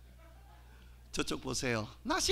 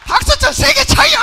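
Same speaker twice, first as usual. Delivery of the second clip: loud, higher push for applause; rally the audience with a shout; shouted command; 8 semitones higher, 17 dB louder than usual. Acted. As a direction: bark at the crowd to clap harder. Demands applause from the crowd.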